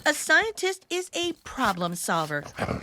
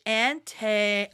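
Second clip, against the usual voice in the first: usual speaking voice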